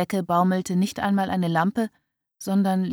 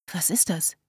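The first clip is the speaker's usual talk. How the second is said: whispered excitement; 5 dB quieter than usual. A narrator's reading. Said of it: "flüsterte sie aufgeregt".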